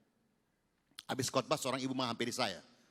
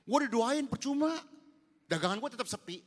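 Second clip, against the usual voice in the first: mocking complaining trader; 10 semitones higher, 4 dB louder than usual. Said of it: "dia cerita kemana-mana".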